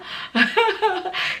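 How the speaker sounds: short high-pitched laugh; amused laugh. Real, not acted. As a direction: laugh lightly mid shout-out. Amused shout out to her friend.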